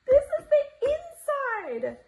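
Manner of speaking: high, slow, sing-song delight; delighted squeal. Real, not acted. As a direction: stretch it out, thrilled. Reveal repeated with delight.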